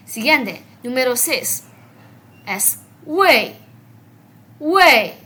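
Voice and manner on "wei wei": "wei" loud, falling sharply from high; otro tono de wei. Shown as a demonstration. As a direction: drop "wei" firmly from a high start. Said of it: "Cuidado con este wei". («wei» fuerte, de agudo a grave)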